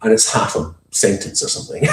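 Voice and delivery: usual speaking voice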